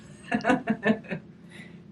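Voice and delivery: short breathy giggle; a quick amused giggle; light laugh. Real, not acted. Teacher amused by toy kitty in pouch, giggling.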